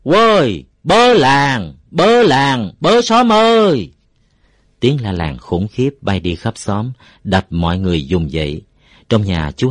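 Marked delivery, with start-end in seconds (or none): 0.0-3.9 loud, high, drawn-out holler; full-throated village alarm. A narrator's reading. Uncle Năm stands in the yard raising the village, full-throated shouting. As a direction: cry out to rouse the whole village. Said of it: "dọn giọng lấy hết gân cổ nổi la làng".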